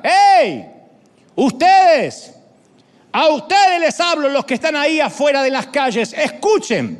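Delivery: high, loud hailing shout; shouting to the street. Acted. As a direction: yell at the passers-by to stop and listen. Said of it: "Y ella grita". (grito agudo y fuerte para llamar a la gente de la calle)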